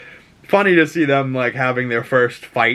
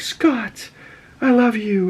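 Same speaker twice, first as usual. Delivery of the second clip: tender farewell; 10 semitones higher, slower than usual. Retold or imitated. Jean telling Scott she loves him, high and tender. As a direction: say goodbye to him, lovingly. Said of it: "then she's like".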